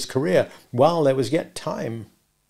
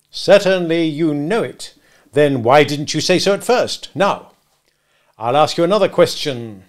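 scornful cross-examiner; bullying retort; 4 semitones higher, 6 dB louder than usual. Acted. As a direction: pounce on the witness. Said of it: "in a bullying, interrogative manner".

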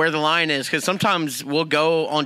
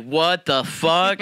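stunned disbelief; much slower than usual. Retold or imitated. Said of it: "And I'm like".

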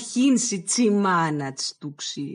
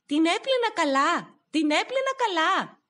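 calling out; 11 semitones higher than usual. Acted. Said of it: "άρχισε να φωνάζει".

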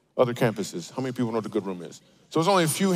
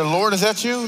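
mock awe; 8 semitones higher, 4 dB louder than usual. Mock awe at the keyboard music.

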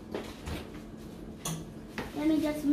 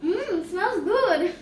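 savoring a smell; 3 semitones higher, much slower than usual. Real, not acted.

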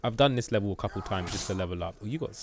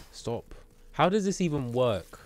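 ordinary delivery

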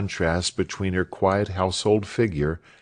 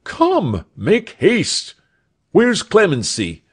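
high, loud urging; urgent summons, voice pitched right up; hurrying servants; 11 semitones higher, 5 dB louder, slower than usual. A narrator's reading. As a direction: call out orders impatiently. Doctor hurries servants, calls maid.

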